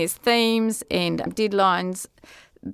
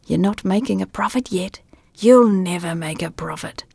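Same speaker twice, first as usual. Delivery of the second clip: unvoiced whisper. A narrator's reading. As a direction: whisper the doubts. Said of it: "the voice whispered".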